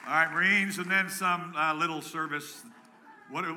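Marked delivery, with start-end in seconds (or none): none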